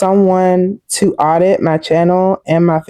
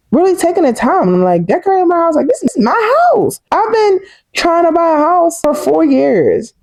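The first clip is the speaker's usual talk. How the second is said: higher, bubbling with joy, fast in places; excited gushing; 10 semitones higher than usual. Real, not acted. Vlogger telling viewers about her new house, excited and much higher.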